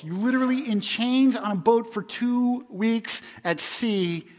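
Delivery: loud, driving sermon delivery; fiery preaching. Acted. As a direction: preach it out hard to the crowd. Preaching at full force.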